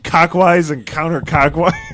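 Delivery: slow, laughing question; laughing pun. Real, not acted. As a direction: giggle through it. Laughing joke on the word.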